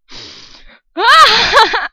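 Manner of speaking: loud high squealing laugh; panicked hysterical laughter. Real, not acted. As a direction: burst into a hysterical giggle. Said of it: "so many weird sounds".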